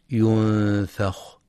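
ينفخ with a thin, light fa, very slow. Shown as a demonstration. Teacher models the correct reading, thin fa. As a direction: keep the fa in ينفخ light, not heavy. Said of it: "لترقيق الفاء".

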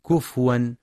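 كفوا with waw and u on the fa; hamza as waw. Shown as a demonstration. Teacher shows the correct Hafs reading, kufuwan. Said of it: "لإبدال الهمزة واوا".

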